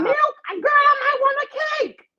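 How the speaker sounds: high, loud exclamation; indignant shout. Real, not acted. Scoffing at the milk question, loud high outburst. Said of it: "Some milk?".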